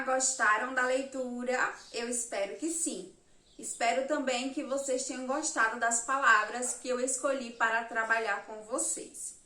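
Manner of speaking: ordinary talk, nothing marked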